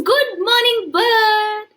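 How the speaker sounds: bright, high greeting as the tortoise; a cheery tortoise saying hello. Acted. Tortoise greeting the bird, high and bright.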